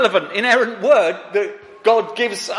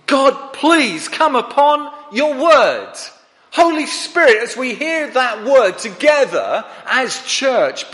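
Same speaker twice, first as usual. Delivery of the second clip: higher, slow earnest plea to God; praying congregant; 5 semitones higher, slower than usual. Retold or imitated. Preacher voicing a churchgoer's prayer for the word, earnest plea. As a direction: pray it aloud like a pleading churchgoer.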